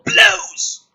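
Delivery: a sudden very high, loud yelp; explosive high yelp; exclaimed. Acted. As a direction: pop the word like the bang itself. Explosion in script reading.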